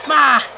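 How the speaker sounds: loud, high "ah" yell; sharp burst of a shout; short yell. Real, not acted. Player jumping in a firefight, short yell.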